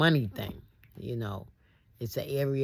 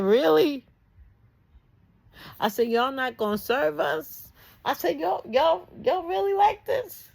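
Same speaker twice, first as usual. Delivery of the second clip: much higher and louder, rising into disbelief; incredulous disbelief; 7 dB louder than usual. Retold or imitated. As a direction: replay the outrage: you really won't serve us?. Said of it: "this is why people think we're emotional".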